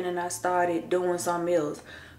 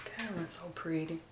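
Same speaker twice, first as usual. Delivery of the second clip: hushed; 14 dB quieter than usual. Real, not acted. Daughter asleep nearby.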